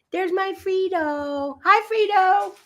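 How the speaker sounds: high, cooing pet voice; high sing-song voice for her little dog. Real, not acted.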